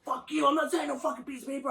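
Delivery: fast, high-pitched angry refusal; mock fury, words tumbling out; retold inner outburst. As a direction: rattle off the defiant thought in a rush. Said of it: "in my head, I was like".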